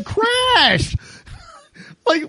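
words squeezed out through a high laugh. Real, not acted. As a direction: laugh while saying it. Laughing at absurd news story.

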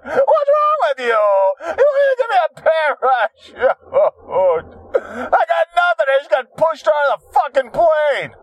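shouted, high complaint; indignant high rant. Real, not acted. Ranting at the bosses about the parachute, high shout. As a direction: yell it like you're wronged.

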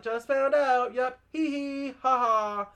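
high, loud put-on chirp with fake giggle; mock awkward cheer. Young woman answering the mayor about the house, mock awkward cheer. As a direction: imitate someone laughing it off.

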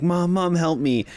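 high, mild mock cry for mom; imitating kids yelling. Retelling the kids calling for Wanda, a mild high mock cry. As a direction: lightly voice the frightened kids. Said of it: "yelling for Wanda".

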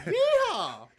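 high, loud mock yeehaw; cowboy holler. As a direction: whoop like a hammed-up cowboy. Joining the Southern weatherman bit.

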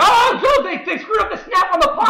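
high laughing yell at the blunder; real excited shout at a botched punt snap. Real, not acted. Sudden blunder in the game.

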